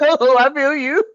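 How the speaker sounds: high, tearful imitation; mock crying. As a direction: act out blubbering in sympathy. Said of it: "I be crying like a motherfucker sometimes".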